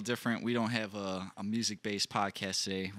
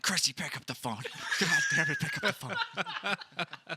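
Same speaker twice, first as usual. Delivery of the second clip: laughing, dragged-out plea; calling his girlfriend while laughing; pleading through laughter; 5 semitones higher than usual. Retold or imitated. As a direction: beg her to answer while cracking up. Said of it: "I'm like".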